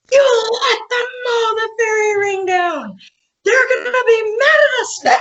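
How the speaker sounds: high, crying and shouting; panicked wail. Retold or imitated. Wife scolds husband for mowing fairy ring, crying panic. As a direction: near tears with dread. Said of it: "I'm like".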